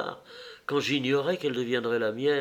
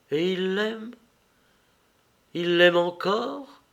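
higher, pained questioning, voice rising at the end; pained murmur; 5 semitones higher than usual. A narrator's reading. Acté learns of Nero's love, murmuring painfully. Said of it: "murmura douloureusement Acté".

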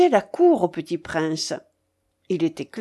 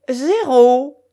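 loud, higher drawn-out announcement; ringing croupier's call; croupier's cry; 5 semitones higher, 8 dB louder than usual. A narrator's reading. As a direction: call it out across the gaming table. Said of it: "cria le croupier".

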